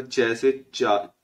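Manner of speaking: च से चा drawn out slowly, letter by letter; teacher spelling out च से चा slowly; slow letter demo. Shown as a demonstration. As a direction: say च से चा slowly and clearly for learners. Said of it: "इसी को ये च से आप याद रख लेना".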